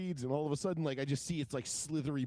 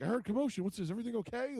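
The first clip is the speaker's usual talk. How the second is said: lightly voiced, higher-pitched worried questions; a hint of an alarmed neighbor checking in; concerned neighbor; 9 semitones higher, faster than usual. Neighbor runs over after a scream, mildly alarmed.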